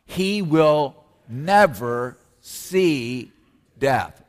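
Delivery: very slow, each word weighed and leaned on; slow emphatic proclamation. Shown as a demonstration. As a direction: let every word land heavily, slowly. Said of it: "One more time".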